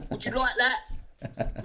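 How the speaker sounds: stretched, mocking question; slow taunt. Real, not acted. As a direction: dangle it slowly in front of him. Taunting David.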